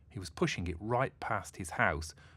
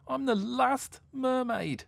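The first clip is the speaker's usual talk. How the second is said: high, loud mock-sobbing mermaid; sobbing pitifully; 6 dB louder, slower than usual. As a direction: wail it through stammered sobs. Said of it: "she sobbed pitifully".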